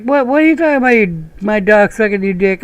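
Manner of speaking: high, indignant squeal; shocked fan. Retold or imitated. Fan offended by the joke, high squeal. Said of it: "it's like".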